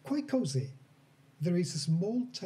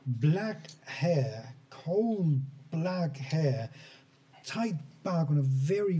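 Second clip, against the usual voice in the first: normal talking voice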